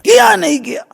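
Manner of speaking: high-pitched mock yell of a demand; retold shout. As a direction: re-enact yelling an accusation, pitch leaping way up. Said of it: "जोर से चिल्लाया". (चिल्लाकर पूछने की नकल, बहुत ऊँची आवाज़ में)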